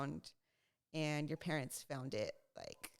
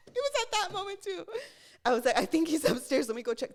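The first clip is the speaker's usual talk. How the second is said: excited; 11 semitones higher, 10 dB louder, faster than usual. Retold or imitated. Retelling eager search for hamster.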